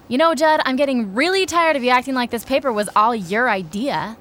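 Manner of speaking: finally snapping at him; angry outburst. Acted. Angry argument over the paper.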